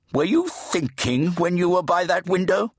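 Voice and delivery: put-on high, demanding deaf old priest; fat Redeemer. A narrator's reading. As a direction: bark at the boy to speak up.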